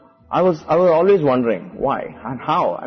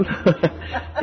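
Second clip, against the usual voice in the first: breathy laugh; 5 semitones higher, 4 dB quieter than usual. Real, not acted. Laughing at own story.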